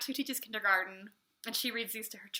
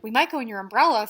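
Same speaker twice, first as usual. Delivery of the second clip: reading Pooh's line; 10 dB louder, faster than usual. Acted. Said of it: "said Pooh".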